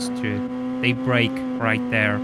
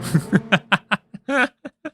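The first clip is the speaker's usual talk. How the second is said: cartoon laugh; 7 semitones higher than usual. Real, not acted. Laugh after the parody.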